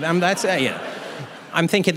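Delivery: normal talking voice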